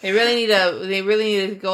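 ordinary talk

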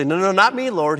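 boastful Peter. Retold or imitated. Preacher voicing boastful Peter to Jesus, loud and sure. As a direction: brush it off like a proud disciple.

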